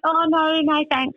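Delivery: high-pitched, polite brush-off; retold polite refusal. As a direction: act out declining nicely.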